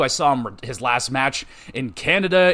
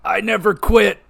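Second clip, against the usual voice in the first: higher, louder cocky wrestler jab; heel taunt; 6 semitones higher, 6 dB louder than usual. Retold or imitated. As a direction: taunt the hometown crowd like a heel. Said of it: "the other time he's just like".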